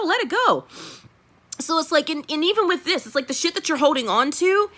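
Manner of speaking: normal talking voice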